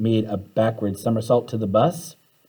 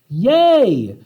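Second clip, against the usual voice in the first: cheer; 9 semitones higher, 6 dB louder than usual. Acted. Cheering at success.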